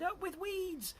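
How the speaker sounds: very high-pitched happy chant; hooting cheer. A narrator's reading. Duckbill herd cheers for weeds, high hoot. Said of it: "And they hooted".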